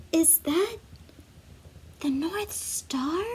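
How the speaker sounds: put-on a young reindeer's hushed question; hushed wonder. Awed reindeer spots the star.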